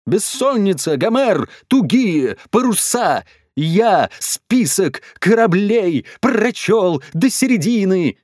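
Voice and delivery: high-pitched calls, word by word; crying out disjointed words. A narrator's reading. Swinging dad declaims verse from the chandelier, crying out. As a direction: call out each chunk separately, pitched high. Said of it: "выкрикивал какие-то отдельные слова".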